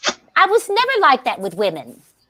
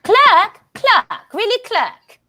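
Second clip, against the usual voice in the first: calling out; 9 semitones higher, much slower than usual. Acted. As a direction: summon the clerk imperiously. Calling the clerk, sketch.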